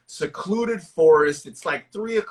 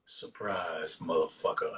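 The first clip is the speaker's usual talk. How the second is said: much lower and softer, slow menacing rumble; deep Tony Todd impression; 12 semitones lower, 12 dB quieter than usual. Impersonating the horror villain for a co-host, deep menacing rumble. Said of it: "he'd actually be like this".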